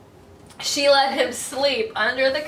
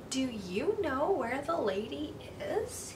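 timid; 9 dB quieter than usual. Acted. Timid dog asks big dog.

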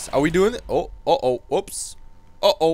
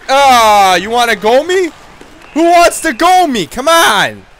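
loud, high challenge; taunting shout; 10 semitones higher, 7 dB louder than usual. Pretend. Enforcer challenging the rival team, loud taunting. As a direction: yell the dare across the ice.

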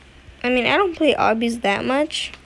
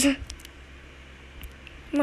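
breathy unvoiced mutter; whispered; 3 semitones higher, 11 dB quieter than usual. Real, not acted. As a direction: say it under your breath. Frustrated under breath.

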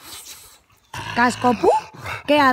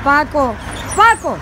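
sharp call, louder and higher the second time; calling the dog; 3 semitones higher, 5 dB louder, slower than usual. Real, not acted. Owner calling her dog Paco to stop him, sharp rising call.

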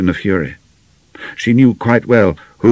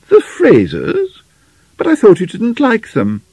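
astonished mother; 6 dB louder than usual. Acted. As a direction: question your daughter in high-pitched surprise. Mother astonished at daughter's plan.

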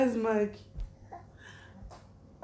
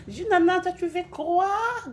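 high, loud burst of greeting; sudden high-pitched outburst; excited exclamation; 9 semitones higher, 6 dB louder than usual. Real, not acted. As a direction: call out loudly, pitched right up.

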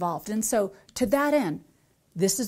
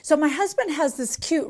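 usual speaking voice

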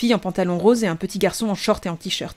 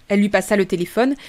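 ordinary delivery

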